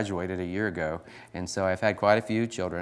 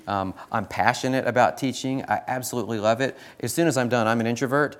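usual speaking voice

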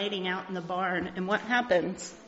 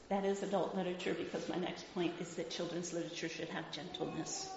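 ordinary talk

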